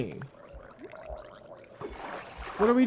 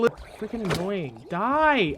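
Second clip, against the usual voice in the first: ordinary talk, nothing marked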